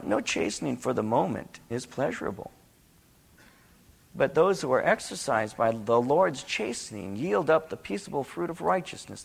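ordinary talk

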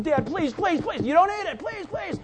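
high-pitched begging to Dad; pleading kid. Retold or imitated. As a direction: plead over and over like a child. Imitates kids begging their parent.

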